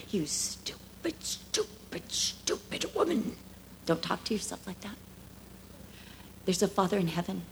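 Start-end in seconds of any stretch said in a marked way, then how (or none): 0.1-3.3 softer whispered hiss of contempt; whispered self-scorn. Retold or imitated. Preacher voicing the cruel inner critic, whispered contempt. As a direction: spit the insult in a whisper. Example of condemning self-talk.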